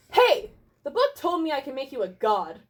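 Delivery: loud, high opening shout that eases off; yelled down. Acted. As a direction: yell down at the tiny blue man. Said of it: "I yelled down at the tiny blue man".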